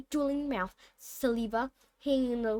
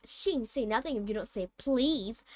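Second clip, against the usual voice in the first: ordinary delivery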